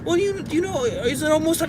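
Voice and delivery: put-on small, high child's voice; imitating timid child. Son asking parent if it's time, timid.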